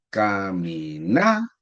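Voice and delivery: "Caminata" slowly sounded out, NA long and rising; stress on NA. Shown as a demonstration. Teacher practising with class, slow "Caminata" with lifted NA. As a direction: stretch "Caminata" syllable by syllable, swelling on NA. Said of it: "mentalmente para comprender esto se tiene que practicar".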